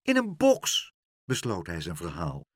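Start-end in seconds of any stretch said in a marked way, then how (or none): 0.0-0.9 very high, incredulous exclamation; bewildered. A narrator's reading. Pluk finishes his story to meneer Pen, bewildered. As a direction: end the tale in disbelief. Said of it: "Verbijsterd ging Pluk naar beneden".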